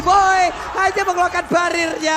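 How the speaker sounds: loud hyped play-by-play; esports caster. Real, not acted. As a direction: ride the fight call upward. Live play-by-play hype. (komentator esports bersemangat)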